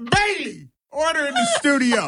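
high laughing call for order; mock judge call. Calling for order jokingly.

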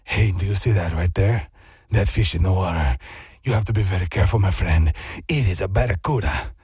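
grave foreign man warning of danger, deep and loud; deep accented stranger. Retold or imitated. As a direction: play the solemn stranger, deep and heavy. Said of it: "and he goes".

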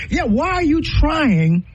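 ordinary talk

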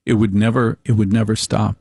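ordinary delivery

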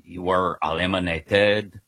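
low, mock French accent, badly faked; terrible French accent. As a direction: put on a lightly done bad French accent. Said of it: "I'll do this terrible accent".

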